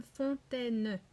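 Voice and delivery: slow "fontaine" in three beats; measured "fontaine", each syllable distinct. Shown as a demonstration. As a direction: stretch "fontaine" out as fon, tai, ne. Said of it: "il a aussi trois syllabes".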